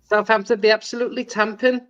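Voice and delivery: put-on a quick hammy Welsh accent. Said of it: "sorry for that horrible Welsh accent".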